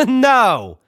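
sarcastic. Retold or imitated. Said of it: "he asks sarcastically".

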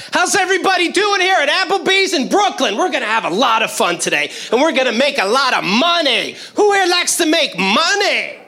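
high, bright mic host voice; peppy corporate trainer. Retold or imitated. Trainer on a microphone firing up two hundred staff, peppy. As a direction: hype up the room of new hires.

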